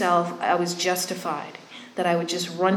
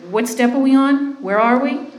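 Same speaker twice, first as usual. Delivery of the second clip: louder, higher sponsor questions; imitated prodding sponsor; 6 semitones higher, 5 dB louder than usual. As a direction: imitate a sponsor pressing for progress.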